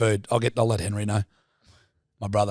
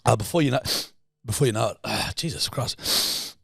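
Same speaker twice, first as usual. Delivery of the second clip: normal talking voice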